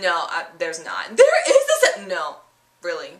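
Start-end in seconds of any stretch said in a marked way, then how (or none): 1.2-2.1 high, loud, half-laughing fake sob; mock wailing kid. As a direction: wail like a heartbroken child. Girl cut off.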